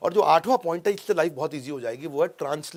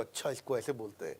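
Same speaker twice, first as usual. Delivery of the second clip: low, soft aside to oneself; hushed, understated "oh, I see"; quiet realisation; 7 semitones lower, 13 dB quieter than usual. Acted. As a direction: voice a learner privately catching on. Voices viewer quietly realising the translation.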